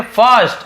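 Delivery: high, punched "फर्स्ट" with "uh"; emphatic correct reading. Shown as a demonstration. Teacher answering own question, high "फर्स्ट" with "uh". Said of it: "क्या पढ़ रहे हैं?".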